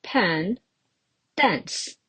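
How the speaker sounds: isolated syllables with long pauses; teacher sounding out syllables. Shown as a demonstration. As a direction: count the word out in beats. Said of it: "There are four syllab".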